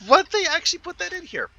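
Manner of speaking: high, rushed burst of disbelief; real surprise. Real, not acted. Player spots an unexpected item in the game, real surprise.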